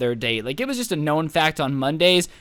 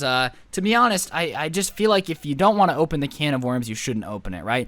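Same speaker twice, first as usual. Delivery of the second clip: normal talking voice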